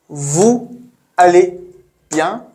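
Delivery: «Vous... allez... bien?» each word separate, with pauses. Shown as a demonstration. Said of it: "Pronounced together as".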